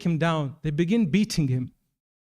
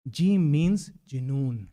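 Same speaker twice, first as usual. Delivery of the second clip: slow reveal; 4 semitones lower, much slower than usual. Retold or imitated. Behlul's key answer, slowed.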